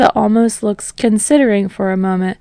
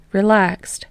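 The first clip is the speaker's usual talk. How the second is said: ordinary delivery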